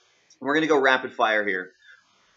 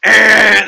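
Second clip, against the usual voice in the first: buzzer noise; 9 semitones higher, 14 dB louder than usual. Acted. Buzzer-style wrong answer noise.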